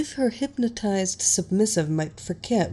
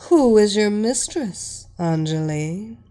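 usual speaking voice

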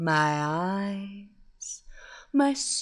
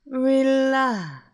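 "Relax." louder and higher, falling firmly; firm hypnotic command; 4 semitones higher, 6 dB louder than usual. Acted. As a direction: press the word "Relax." home with force. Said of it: "my hypnotic voice".

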